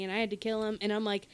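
ordinary talk

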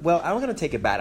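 ordinary talk, nothing marked

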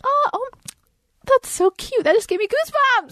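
high, squealed aww of delight; touched gushing. Real, not acted. Host reacting to sweet song story, touched gushing.

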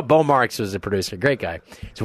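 ordinary talk

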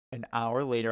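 normal talking voice

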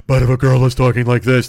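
mock deep put-on voice; exaggerated fake-deep woman's voice; Elizabeth Holmes. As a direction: force the voice down deep and project it. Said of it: "She got a voice deeper than me".

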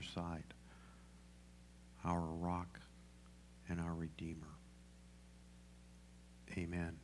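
soft, low, unhurried praying voice; prayer. Real, not acted. Pastor asking God to guide the sermon, quiet prayer. Leads a prayer before preaching.

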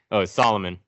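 ordinary talk, nothing marked

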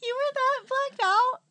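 high, slow, disbelieving; surprised. Real, not acted. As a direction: ask in shock, laughing. Shocked friend, high and slow.

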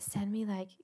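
soft unvoiced whisper. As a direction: whisper it low and breathy. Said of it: "he was like".

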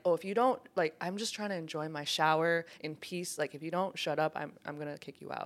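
soft stern warning; mild retold threat. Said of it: "You said it like that?".

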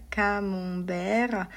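camembert slowly, nasal vowel, silent t; drawn-out French camembert. Shown as a demonstration. Teacher repeating the French camembert slowly. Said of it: "czyli no właśnie".

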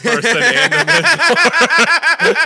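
laughing. Real, not acted. Mocking movie, laughing.

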